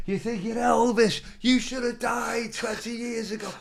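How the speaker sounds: high, stretched-out mock jeering; heckler. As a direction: heckle like someone calling from the crowd. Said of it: "The heckler, they give him".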